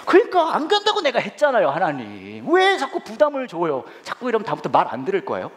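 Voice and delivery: complaining prayer. Retold or imitated. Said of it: "막 그렇게 기도했어요".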